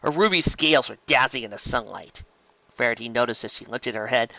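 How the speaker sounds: plain reading voice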